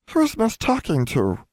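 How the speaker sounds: high, slow little elf voice; house elf voice. A narrator's reading. House elf interrupting the girl, high slow creature voice. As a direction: pitch it high, draw out the question.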